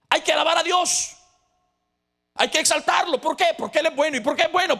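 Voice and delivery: high, loud fervent preaching; rally the congregation, voice lifted; preaching at full force. Acted. Preacher rallying the congregation, high and loud fervent call.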